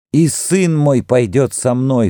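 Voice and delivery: high, drawn-out desperate cry. A narrator's reading. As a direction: insist in anguish on keeping your son. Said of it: "вскричала Сара". (отчаянный возглас высоким голосом)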